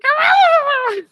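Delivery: loud high gobble; terrible turkey impression. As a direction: squawk out a bad turkey gobble. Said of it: "terrible turkey impression".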